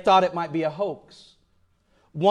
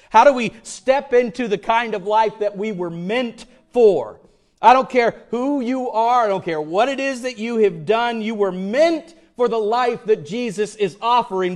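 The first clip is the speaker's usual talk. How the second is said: louder, higher and rising call to the crowd; full-force preaching; 6 semitones higher, 5 dB louder than usual. Acted. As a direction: preach it out to the whole room.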